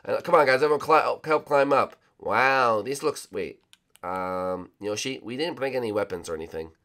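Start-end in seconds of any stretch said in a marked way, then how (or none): none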